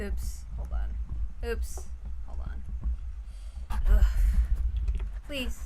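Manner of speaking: usual speaking voice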